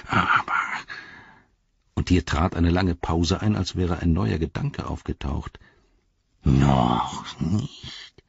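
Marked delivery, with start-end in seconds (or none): 0.1-0.5 Gollum voice. Acted. 6.4-8.2 lower, slow hissing noch nicht; low Gollum voice. Acted.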